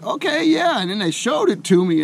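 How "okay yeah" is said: much higher, eager agreeing; eager yes. Retold or imitated. Tourist agrees to try the drink, high and eager. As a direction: jump up in pitch and say yes. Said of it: "And I'm like".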